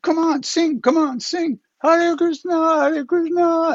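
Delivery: very high, slow coaxing chant; lama nudging his friend to sing along; Rinpoche urging. Retold or imitated.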